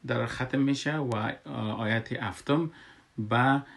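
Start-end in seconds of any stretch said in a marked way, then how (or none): none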